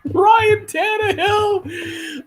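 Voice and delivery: high excited shout, stretched name. Real, not acted. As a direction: yell the name in disbelief. Reacting to a play.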